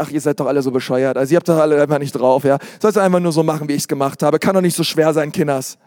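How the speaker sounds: mock-grumpy God rattling off complaints; exasperated God. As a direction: sneer like a fed-up deity at foolish children. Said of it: "denkt dir".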